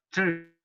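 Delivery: "chur" said with almost no vowel between ch and r; "chur" as one consonant cluster. Shown as a demonstration. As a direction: clip "chur" down to ch and r. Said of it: "Solamente iría la ch y la r".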